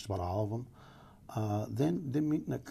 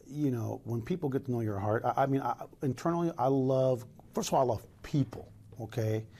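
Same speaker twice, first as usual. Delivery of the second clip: usual speaking voice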